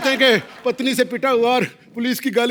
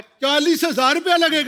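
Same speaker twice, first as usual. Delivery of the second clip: high, shocked price echo; 6 semitones higher than usual. Real, not acted. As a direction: gasp at the price.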